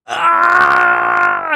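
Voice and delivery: high, drawn-out yell; high-pitched wail of exasperation; exasperated scream. Real, not acted. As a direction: let out a long frustrated "Ah!". Outburst of exasperation at what was read.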